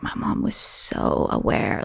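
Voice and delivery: slow, hushed whisper; awed whisper. Real, not acted. Grown daughter marvelling at her mom, awed whisper. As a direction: whisper it slowly, full of wonder.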